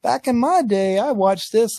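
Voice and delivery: high, put-on old-timer reminiscing. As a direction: mimic a nostalgic old-timer. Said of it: "some people will say".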